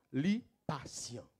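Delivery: normal talking voice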